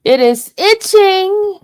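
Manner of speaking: itching pulled out high and loud; stress on itching. Real, not acted. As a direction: lean hard on itching, slowly. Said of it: "but it's itching".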